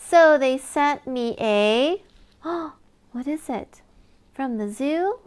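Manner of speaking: lively storybook reading with big reveals; reading to children. Acted. Reader sharing a lift-the-flap book with a child, animated. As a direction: read it like bedtime story time for a toddler. Reads picture book aloud.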